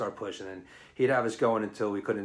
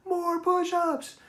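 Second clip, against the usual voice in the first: much higher, mock-serious call from the ranks; cheeky shout. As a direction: heckle like a drill sergeant. Joking voice from the crowd.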